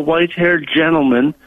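usual speaking voice

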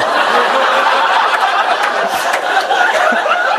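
loud high laughter. Real, not acted. Retelling Father Steve's quip, laughing loudly.

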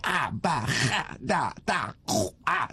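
"ba, ha, da" rasped out from the throat; exaggerated throat sounds on "ha" and "ku"; throaty Arabic alphabet. Shown as a demonstration. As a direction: recite "ah, ba, ha, da, da, ku, ah" as separate guttural sounds. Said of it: "you get a sore throat".

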